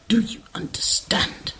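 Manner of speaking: cold whispered threat; whispered warning from the villainess to her hired man; menacing whisper. Acted.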